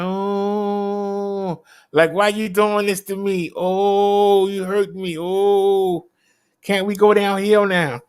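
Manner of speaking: lightly voiced whimpering little motor; mock-pitiful motor begging for downhill; crying motor voice. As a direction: plead like a worn-out motor begging to stop. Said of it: "you didn't hear the motor crying".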